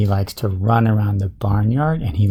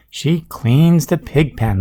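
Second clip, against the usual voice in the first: usual speaking voice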